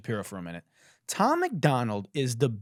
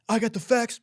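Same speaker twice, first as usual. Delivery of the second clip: high, punched rap backup; emphasis double; 8 semitones higher, 3 dB louder than usual. Shown as a demonstration. Host demonstrating emphasis doubles, high punched delivery. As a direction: hit the whole phrase as hype vocal. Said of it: "what's called emphasis doubles".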